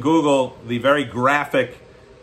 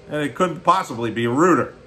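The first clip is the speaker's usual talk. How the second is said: ordinary delivery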